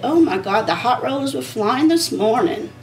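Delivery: ordinary talk, nothing marked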